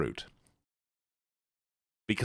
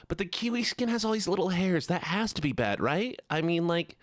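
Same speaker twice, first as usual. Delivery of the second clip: high-pitched whiny objection; put-on whiny voice; 6 semitones higher than usual. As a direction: argue in a high whine. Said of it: "it's up high, and it's all whiny".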